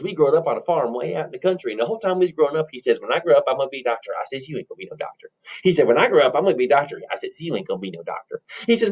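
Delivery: rambling rustic yarn with acted childhood squabble; countrified voice, seesawing between boast and muttered retort; country bumpkin storyteller. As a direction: reminisce like a farm boy. Plays countrified twin brother.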